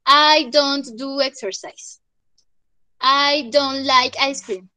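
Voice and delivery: slow teaching model. Shown as a demonstration. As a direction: spell it out slowly for the class. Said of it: "teacher modelling slow examples".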